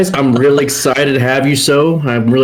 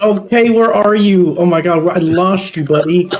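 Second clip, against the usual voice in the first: panicked calling; 8 semitones higher than usual. Real, not acted. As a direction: call out in real alarm. Lost co-host on stream.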